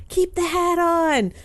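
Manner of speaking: high, drawn-out call, as if out a car window; imitated yell. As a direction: stretch it out like a passerby hollering. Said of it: "people would yell out their windows".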